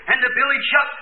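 ordinary talk

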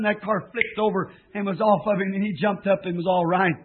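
ordinary delivery

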